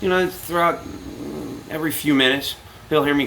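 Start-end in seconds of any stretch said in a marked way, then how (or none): none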